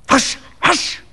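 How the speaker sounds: high, whispered exclamation; urgent hushing. A narrator's reading. Doctor silencing Fettes in the inn hall, urgent whisper. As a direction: hush someone urgently under your breath. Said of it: "exclaimed the doctor".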